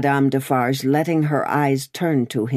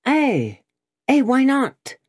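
much higher, louder eager cry; 11 semitones higher, 3 dB louder than usual. Acted. Frightened wood sawyer answering Madame, an eager high cry. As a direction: blurt it out eagerly. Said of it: "cried the sawyer".

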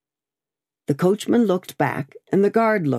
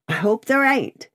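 high, lightly gruff grumble; gruff soliloquy; 7 semitones higher than usual. A narrator's reading. Guard doubts the rider to himself, mildly gruff soliloquy. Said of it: "said the guard in gruff soliloquy".

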